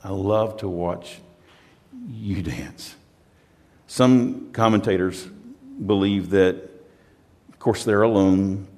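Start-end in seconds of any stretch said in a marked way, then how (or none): 0.0-3.0 soft, slow, lowered and loving; a husband sings to his bride; tender love words. Retold or imitated. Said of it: "He says".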